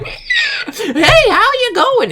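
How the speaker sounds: very high, mock shouted greeting; tunnel trog. Man hobbling out behind the gym greets him, tunnel trog screech. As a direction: squawk it like a creature from a tunnel. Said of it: "like some fucking tunnel trog".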